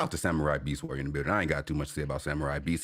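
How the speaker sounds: deep mock voice shouting out a guest; deep voice drop.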